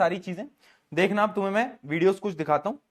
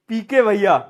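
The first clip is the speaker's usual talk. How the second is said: mild imitation of a class shouting in answer; retold class shout; 5 dB louder than usual. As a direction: call it out a little louder, like pupils. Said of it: "पूरी class चिल्लाई". (बच्चों की हल्की नकली पुकार)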